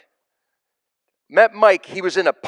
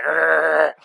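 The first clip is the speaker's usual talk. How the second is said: long, drawn-out "arrr" noise; imitated growl. Speaker imitating shaved-head friend on first meeting, growled. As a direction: growl it out as an impression. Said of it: "It was like".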